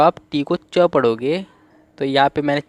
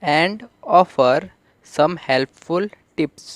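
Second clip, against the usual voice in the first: each word read out slowly and carefully; slow classroom read-aloud; slow reading demo; much slower than usual. Shown as a demonstration. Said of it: "तो helpful को यहाँ पे हम देख लेते हैं".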